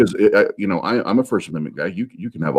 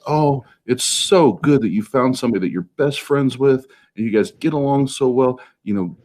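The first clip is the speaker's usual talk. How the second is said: higher-pitched mock gushing; gushing imitation; 6 semitones higher than usual. Church friends congratulating him on his engagement, gushing mockingly.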